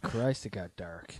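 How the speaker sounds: unvoiced whispered remark; whispered aside. Real, not acted. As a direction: whisper it under your breath. Remark on weather.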